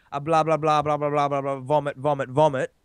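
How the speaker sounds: low monotone babble; low, run-on mock droning; dismissive drone. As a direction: drone it out like tedious rubbish. Dismissive list of negative feelings.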